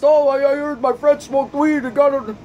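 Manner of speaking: high, put-on voice for the gullible friend; mocking quote. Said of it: "And all these tests".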